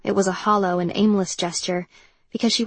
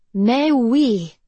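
hammy fake French accent, a bit louder; terrible French accent; 3 semitones higher, 5 dB louder than usual. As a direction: ham up a bad French accent. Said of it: "in the terrible French accent".

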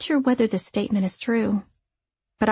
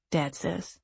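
usual speaking voice

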